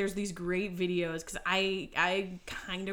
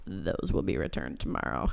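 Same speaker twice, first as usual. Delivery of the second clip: dad's deadpan aside. Retold or imitated. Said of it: "turns around to the camera and goes".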